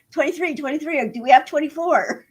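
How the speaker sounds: lightly voiced auctioneer. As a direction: call bids like an auctioneer. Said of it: "I feel like an auctioneer".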